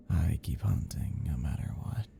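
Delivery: a menacing whisper to the victim. Acted. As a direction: whisper it like a predator. Stalker describing bloodlust.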